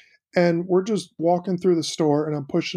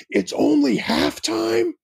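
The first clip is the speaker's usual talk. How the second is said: high, drawn-out outraged question; loud dismayed question; 11 semitones higher, much slower than usual. Retold or imitated. Dad imitating his son loudly dismayed at intermission. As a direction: sound betrayed that it isn't over. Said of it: "he really loudly".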